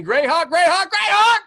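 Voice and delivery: high, loud excited yelling; screaming. Retold or imitated. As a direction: call it out at the top of your lungs.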